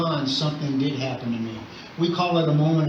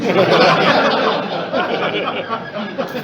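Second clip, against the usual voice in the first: real laugh; 7 semitones higher, 7 dB louder than usual. Real, not acted. Laughing at own joke.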